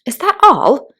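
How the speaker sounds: high, loud, indignant question; barely holding back annoyance; suppressed anger. A narrator's reading. Alice answering the caterpillar, indignant. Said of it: "swallowing down her anger".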